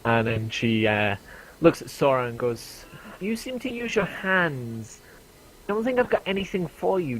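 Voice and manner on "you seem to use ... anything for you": put-on high lady merchant; merchant woman. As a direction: play the shopkeeper sizing up a customer, high.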